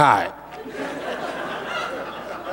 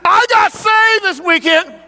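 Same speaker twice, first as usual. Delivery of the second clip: put-on proud little boy; 11 semitones higher, slower than usual. Boy's announcement retold again.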